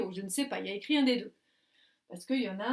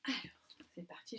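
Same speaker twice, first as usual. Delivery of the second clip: whispered, hushed start; hushed story-time whisper; 10 dB quieter than usual. Real, not acted. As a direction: whisper before reading.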